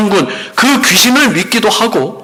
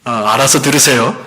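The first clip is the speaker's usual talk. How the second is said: normal talking voice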